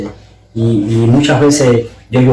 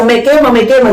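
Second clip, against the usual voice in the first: retold urgent outcry; 11 semitones higher than usual. He cries out as the fire overwhelms him, retold urgently and high. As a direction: re-enact crying out from the burning, pitched high. Retells crying out under spiritual fire.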